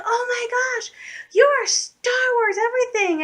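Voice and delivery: very high, gushing fangirl impression; freaking-out young fans. Girls seeing the BB-8 wallet, imitated freak-out. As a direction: squeal it like amazed little girls. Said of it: "all the girls are freaking out".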